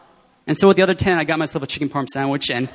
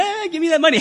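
high, grabby mock voice demanding money; high put-on voice, greedy and teasing; mock greedy God; 11 semitones higher than usual. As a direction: play a greedy trickster snatching cash. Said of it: "He's not being like".

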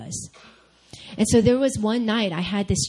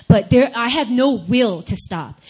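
usual speaking voice

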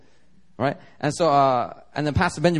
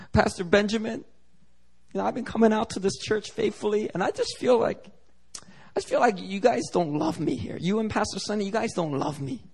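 higher-pitched, hurt pleading voice; imitated tearful complaint; 6 semitones higher than usual. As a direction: mimic an upset church sister whining to her pastor. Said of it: "just crying".

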